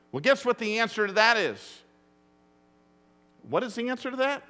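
ordinary talk

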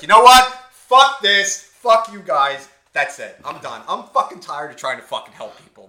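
loud, high-pitched exasperated rant; fed-up outburst. Retold or imitated. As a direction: re-enact blowing up and giving up on them. Retelling his fed-up outburst at the couple.

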